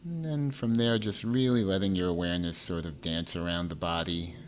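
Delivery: measured meditation delivery, phrases spaced far apart; guided meditation. Acted.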